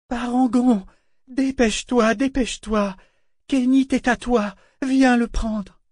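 high urgent call across the water; pressing high-pitched hail. A narrator's reading. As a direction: summon someone far off, voice way up. Ship calling out to Parangon.

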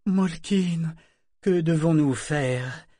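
ordinary delivery, nothing marked

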